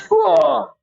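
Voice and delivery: high sudden yelp; hammed-up cry of pain; mock scream. Storyteller plays the first soldier struck, mock scream. Acts the first victim being hit.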